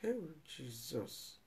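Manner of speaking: barely voiced cry to Jesus; deep sorrowful groan of a prayer; pained sigh. Real, not acted. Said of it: "the thing touch me".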